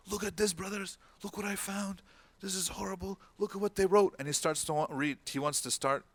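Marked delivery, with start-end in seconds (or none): 0.1-4.1 high, thrilled voice pitched well up, rising to a loud finish; a brother bursting in with his find, high and eager; excited brother. Retold or imitated. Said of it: "He got super excited".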